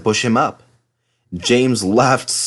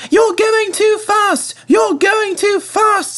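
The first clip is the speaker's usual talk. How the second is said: very high, slow, shouting carriages; slow squeaky warning; alarmed coaches; 3 dB louder, slower than usual. A narrator's reading. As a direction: coaches crying out in protest. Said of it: "said the coaches".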